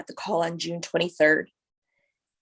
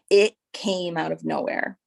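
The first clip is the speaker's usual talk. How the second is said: usual speaking voice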